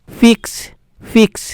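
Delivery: "ফিক্স" begun with an English f; half ফ. Shown as a demonstration. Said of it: "অর্ধেক ফ এর উচ্চারণ হচ্ছে".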